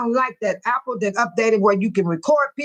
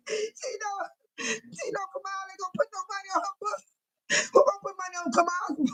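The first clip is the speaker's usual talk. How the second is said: words squeezed out, much softer, through laughter; wheezing with laughter, barely voiced; laugh-crying; 5 semitones higher, 9 dB quieter than usual. Real, not acted. Host in tears of laughter at her own joke, wheezing.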